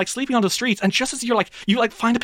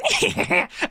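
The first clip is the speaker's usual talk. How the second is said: goblin cackle; 13 semitones higher than usual. Acted. Goblin laughs from inside tavern.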